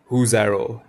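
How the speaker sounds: stern demand. A narrator's reading. Peter faces his band over the arrow, stern. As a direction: demand it firmly, as a storybook leader. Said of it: "he demanded sternly".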